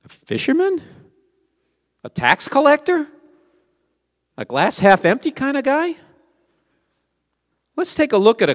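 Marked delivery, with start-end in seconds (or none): 0.0-3.1 high, rising mock questions; a raised, questioning lilt on each suggestion; incredulous guesses. 4.4-6.0 rhetorical guess. Acted.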